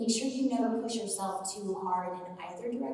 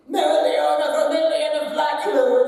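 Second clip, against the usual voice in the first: full-out yell, higher and very loud; Mereoleona Vermilion; 5 semitones higher, 11 dB louder than usual. Shown as a demonstration. Voice actor showing how her character yells everything, loud yell. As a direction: yell every word from the chest. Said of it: "She yells everything".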